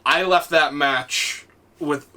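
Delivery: ordinary talk, nothing marked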